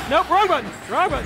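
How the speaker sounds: high, urgent yelp; flustered panic. Real, not acted. Player hitting the wrong button, flustered. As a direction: blurt it out in alarm.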